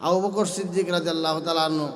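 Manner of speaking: usual speaking voice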